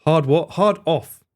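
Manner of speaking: usual speaking voice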